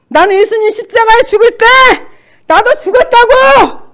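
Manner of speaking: high, full-force declaration; shouted defiance. Retold or imitated. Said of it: "씨름하고 난리가 났어요".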